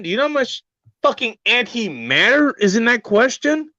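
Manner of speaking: a ranting crescendo of questions; escalating rant. Acted. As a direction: keep building the hype to a peak.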